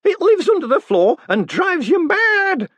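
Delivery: enjoy saying it; old woman delighting in a spooky tale; relishing old woman. Acted. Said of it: "enjoying the syllable so much".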